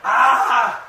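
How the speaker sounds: loud, high pained groan; anguished cry. Acted. Preacher voicing God's pained groan. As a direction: cry it out like a wounded God.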